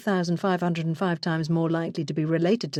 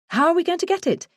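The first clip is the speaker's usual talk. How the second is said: higher and fast, pressing; impatient eagerness; 12 semitones higher, faster than usual. Retold or imitated. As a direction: push him for an answer, fast. Impatient exchange with boss.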